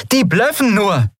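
high, forced confident call; unconvincingly confident. Acted. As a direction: try to sound sure and fail. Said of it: "Sie wollte zuversichtlich klingen, schaffte es aber nicht".

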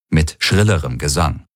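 ordinary delivery, nothing marked

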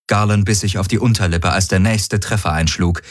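ordinary delivery, nothing marked

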